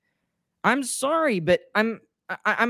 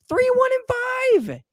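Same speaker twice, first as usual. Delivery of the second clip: much higher, slow, shouted in real frustration; exasperated shout; slower than usual. Real, not acted. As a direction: yell the record out in disbelief. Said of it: "I'm so frustrated".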